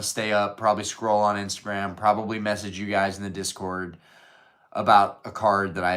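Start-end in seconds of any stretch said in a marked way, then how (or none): none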